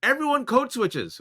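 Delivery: hammed-up reading of a preachy tweet; mock tweet voice. Reading a tweet to folks, mock announcer. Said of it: "several other people did this tweet, like".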